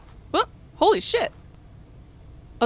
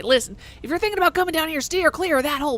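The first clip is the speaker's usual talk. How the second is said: fast, louder warning; urgent warning; 5 semitones higher, 4 dB louder, faster than usual. Acted. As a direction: hurry the warning out. NPC warning.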